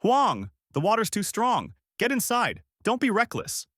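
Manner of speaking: high-pitched urgent warning yell. A narrator's reading. Uncle warning Huang of the flood, urgent high yell. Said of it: "Uncle Tu yelling".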